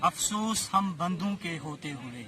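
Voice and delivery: measured mushaira verse recital; poetry recitation. Acted. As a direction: recite the couplet with phrase pauses.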